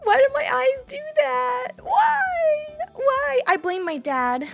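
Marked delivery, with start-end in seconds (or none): 0.0-3.4 high, drawn-out whiny lament; mock wailing. VTuber laments her crying eyes, mock wailing. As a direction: wail it upward like a hammed-up crybaby.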